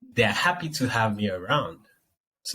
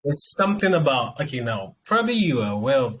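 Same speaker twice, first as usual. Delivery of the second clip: usual speaking voice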